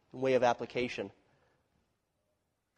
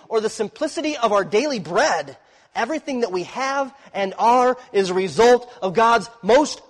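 louder and higher, driving each phrase home; full-force preaching; 7 semitones higher, 7 dB louder than usual. Real, not acted. Preacher proclaiming God's provision to his congregation, forceful and raised.

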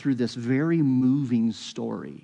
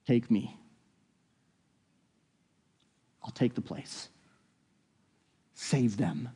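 soft, lower self-sacrifice; quiet offer; 3 semitones lower, 7 dB quieter than usual. Acted. Judah offering himself in place of Benjamin, quietly. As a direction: offer yourself in a hush.

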